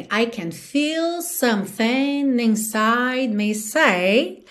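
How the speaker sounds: slow, words stretched to the beat; song rhythm. Shown as a demonstration. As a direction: draw it out as in the song. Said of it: "no ritmo da música a gente tem".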